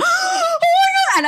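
high shocked shriek of oh my God; a scared, breathless cry out; terrified gasp. Retold or imitated. Retelling walking in on Walter in the dark, terrified shriek.

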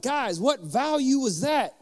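high, loud and slow, stretching every word; a dad hammed-up quizzing the kids; excited quiz question. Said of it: "I hit it and I was like".

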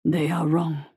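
put-on low, slow accented voice; deep slow voice with authority. A narrator's reading. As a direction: speak with cold authority. Creature voice continues.

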